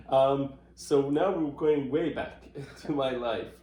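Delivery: chuckling through the words, amused; laughing. Real, not acted. Laughing at the childhood question.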